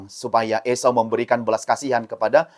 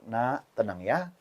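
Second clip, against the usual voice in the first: calm the little one down gently; soothing mother; 4 semitones lower, 11 dB quieter than usual. Acted. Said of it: "ketika mama peluk".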